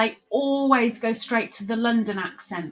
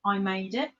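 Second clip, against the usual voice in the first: mock-modest reply; 3 semitones lower, 6 dB quieter than usual. Imagined reply showing off.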